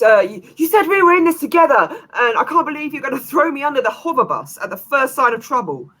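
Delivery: high, loud, fast shout of betrayal; angry shout. Acted. Merchant shouting at the scientist who betrayed him, high and furious. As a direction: yell it fast and high like you've been stabbed in the back. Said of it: "and shouts".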